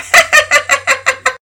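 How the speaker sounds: shrill fit of giggles; Penelope laughing at her own joke; high giggling laugh. A narrator's reading. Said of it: "through fits of laughter".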